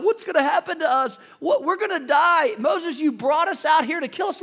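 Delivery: high, frantic mock panic; preacher voicing people sure they'll die; panicked Israelites. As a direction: act out the terrified crowd. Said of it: "They're thinking about".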